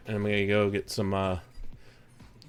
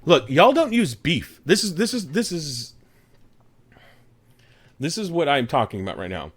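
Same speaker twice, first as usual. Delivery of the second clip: pitched-up indignant barbecue rant, starting loud; mock outrage; 4 semitones higher, 3 dB louder than usual. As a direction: sputter in hammed-up disbelief. Mock outrage defending Texas barbecue.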